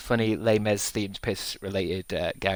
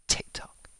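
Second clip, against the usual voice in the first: whisper; 6 dB quieter than usual. Acted. Whispered aside on TikTok.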